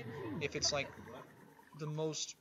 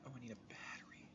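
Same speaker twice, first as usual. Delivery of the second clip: whispered quick aside to self; 2 semitones lower, 15 dB quieter, faster than usual. Real, not acted. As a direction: breathe it out fast under breath.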